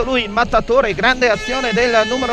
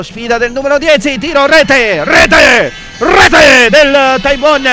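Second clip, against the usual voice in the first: loud goal shout; breathless italian goal commentary; 4 semitones higher, 7 dB louder, slower than usual. Real, not acted. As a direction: yell the goal, stretching the last call slowly. Goal call in live commentary.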